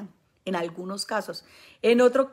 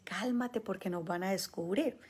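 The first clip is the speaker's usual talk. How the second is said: demon's hushed warning; 7 dB quieter than usual. Retold or imitated. Demon warning its host, softer and hushed. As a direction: hush him like a demon afraid of being caught. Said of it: "el demonio le dice".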